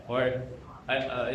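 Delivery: normal talking voice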